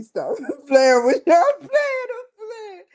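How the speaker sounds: high giggling through words. Real, not acted. Teasing viewers, giggling. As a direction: laugh it off.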